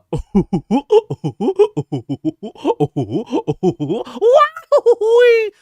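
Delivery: loud high goofy cartoon laugh. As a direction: hyuck it up like Goofy. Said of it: "that's Goofy".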